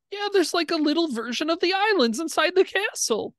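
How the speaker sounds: squeaky high little voice; the shrunken Minotaur pipes up; tiny squeak. Acted.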